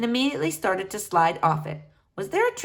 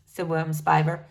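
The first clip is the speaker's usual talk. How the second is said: ordinary delivery, nothing marked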